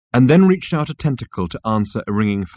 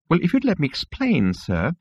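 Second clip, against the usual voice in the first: higher, softly tetchy voice; petulant insect clerk; 7 semitones higher, 3 dB quieter than usual. Acted. Insect receptionist tries to explain to Zaphod, petulant. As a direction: explain with strained politeness. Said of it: "tapping the most petulant of all the tentacles".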